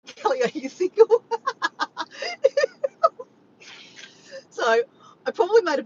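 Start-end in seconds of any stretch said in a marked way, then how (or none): 0.1-1.2 loud, higher, cracking up; laughing while reading. Real, not acted. Cracking up reading chat message. 1.3-3.2 long, high, loud cackling laugh; helpless laughter. Real, not acted. Cracks up at chat message.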